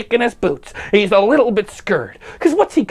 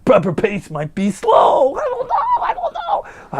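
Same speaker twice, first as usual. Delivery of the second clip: mock Trump voice; 5 semitones higher, 4 dB louder than usual. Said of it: "being like".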